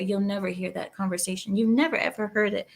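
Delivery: usual speaking voice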